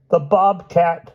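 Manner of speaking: high, drawn-out frightened whimper; hammed-up storybook fear; whimpering Hyde. A narrator's reading. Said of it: "whimpered Hyde".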